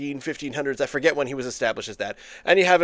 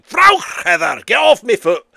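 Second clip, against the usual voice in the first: loud, high Scottish yell of pain; Scottish shout; 7 semitones higher, 10 dB louder than usual. Acted. Heather steps on a Scottish dancer's foot, he yells in Scottish accent. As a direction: bellow it like a Scot whose foot got stepped on.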